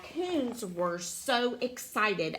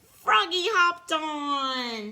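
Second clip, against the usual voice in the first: slow, higher, louder reveal; excited announcement; 4 semitones higher, 5 dB louder, slower than usual. A narrator's reading. Reader reveals a new character to child, excited. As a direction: stretch the words out with storybook excitement. Storybook surprise, stretched out for child.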